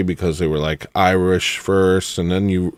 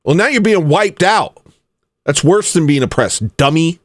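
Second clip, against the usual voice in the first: exasperated rant; 6 semitones higher than usual. Real, not acted. Ranting at the band.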